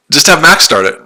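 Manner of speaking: loud, high, eager calls to go live right now; excited shouting. Real, not acted. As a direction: pump him up to start the stream.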